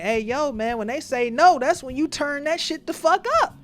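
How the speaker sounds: high, loud mock egging-on; hyped-up urging. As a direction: imitate someone hyping a friend up, pitch climbing. Imitates coworkers urging him on.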